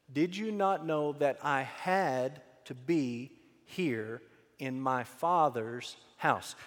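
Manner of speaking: measured answer, word by word; unhurried, weighty reply; slow and deliberate. Acted. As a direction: say it slowly, with space between phrases. Jesus's answer, spoken slowly.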